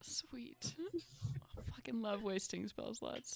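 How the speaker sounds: dry whispered "sweet"; whispered sarcasm. Real, not acted. Player whispering sarcastically about taking damage. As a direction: whisper it sourly.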